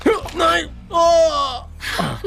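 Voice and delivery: high, drawn-out wail of pain; pained scream. Acted. Captive begging as they press harder, pained wail. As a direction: cry out as the pressure bites.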